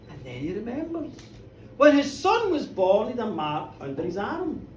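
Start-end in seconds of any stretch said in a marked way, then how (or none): none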